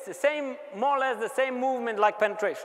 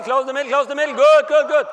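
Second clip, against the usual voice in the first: loud, higher shouted commands; coach yelling; 5 semitones higher, 11 dB louder than usual. Real, not acted. Coach shouting at players to close the middle. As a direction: bellow it to the defense.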